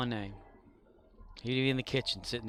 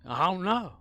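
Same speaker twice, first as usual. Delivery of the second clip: put-on drunk dad voice; 8 semitones higher, 7 dB louder than usual. Drunk dad shrugging off question.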